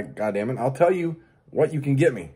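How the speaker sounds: ordinary talk, nothing marked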